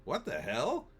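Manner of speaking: mildly baffled, questioning lift at the end; understated bewilderment; mild exclamation. Retold or imitated. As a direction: exclaim it gently, with no anger. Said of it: "I exclaim pretty mildly".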